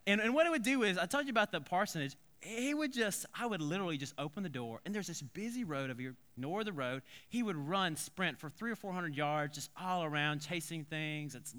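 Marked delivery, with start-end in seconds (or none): none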